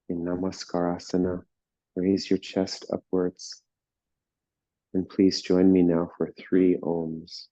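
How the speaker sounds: slow, calm meditation voice with long pauses; guided meditation. Real, not acted. Teacher settling a class into meditation, slow and calm. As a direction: ease them into stillness, unhurried.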